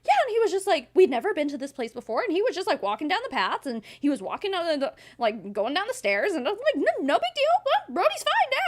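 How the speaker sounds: very high, bright-eyed rush of words; hammed-up excited pet owner. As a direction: gush like the thrilled dog mom. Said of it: "She's like".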